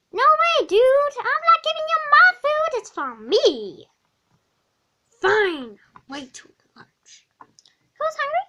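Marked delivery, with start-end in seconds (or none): 0.1-3.9 high, loud, indignant refusal; greedy outburst. Acted. Hungry kid refusing to share food, high and loud. 6.1-7.3 soft whispered mutter; whispered grumble. Acted. Depressed kid muttering to the hungry kid, whispered.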